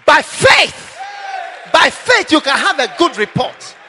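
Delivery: loud, rousing preacher's shout; full-voiced call to climb every mountain; fiery preaching. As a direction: preach it to the back row.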